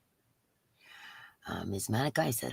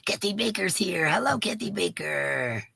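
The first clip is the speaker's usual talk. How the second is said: usual speaking voice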